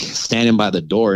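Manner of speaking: ordinary talk, nothing marked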